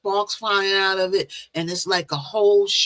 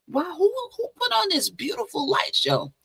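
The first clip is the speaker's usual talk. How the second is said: much higher, wonder-struck voice; awed wow; 12 semitones higher than usual. Retold or imitated. Marvelling at a dream light show with friends, awed and high. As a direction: gasp in amazement at the sky. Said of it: "And then we were like".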